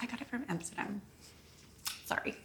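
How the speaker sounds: tiny, very soft imitated reply; put-on apologetic voice. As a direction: shrink the brag into a hushed little apology. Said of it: "you use that voice when you say it".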